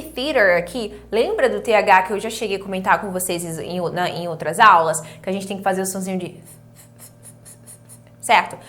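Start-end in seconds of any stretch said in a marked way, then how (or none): none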